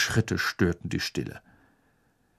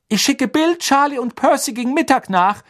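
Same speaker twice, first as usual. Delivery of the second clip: mother voiced; 5 dB louder than usual. A narrator's reading. Mother addressing husband.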